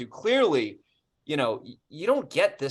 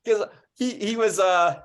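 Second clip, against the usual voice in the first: bursting out laughing, much higher and louder; 10 semitones higher, 11 dB louder, slower than usual. Real, not acted. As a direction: laugh through the words, can't hold it in. Cracking up over the call.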